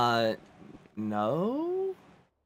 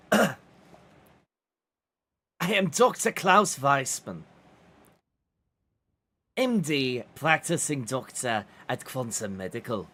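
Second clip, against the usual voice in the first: put-on German doctor accent. Doctor character introducing himself to a player in German accent. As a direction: introduce yourself as a stiff German physician. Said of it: "I am trying to do German".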